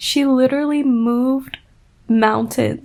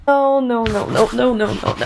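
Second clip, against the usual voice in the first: panicked denial. Real, not acted. Panicking at a line.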